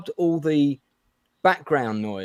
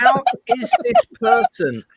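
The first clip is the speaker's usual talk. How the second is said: much higher and louder, slow and disbelieving; how is this even possible, pitched way up; incredulous; 7 dB louder, slower than usual. Real, not acted. Ranting about the bad writer.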